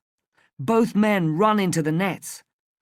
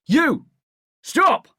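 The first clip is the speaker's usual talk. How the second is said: very high, loud yells; an angry shout after the fleeing boy; 10 semitones higher, 6 dB louder than usual. A narrator's reading. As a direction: bark the order to stop. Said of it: "he shouts to the other smuggler".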